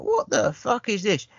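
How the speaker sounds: mocking the thug's squeaky high voice; high-pitched voice. Host repeats the villain's line in a squeaky high voice. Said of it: "you hear his high-pitched voice".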